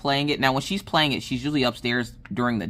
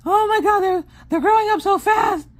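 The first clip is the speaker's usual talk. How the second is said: high, loud, hammed-up panic; paranoid mama panic; 5 dB louder than usual. Mom frets over kids out of sight, in high mock panic. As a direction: squeal it like a frantic mother. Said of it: "Mama Paranoid Brain over here is just like".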